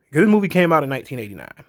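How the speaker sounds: ordinary talk, nothing marked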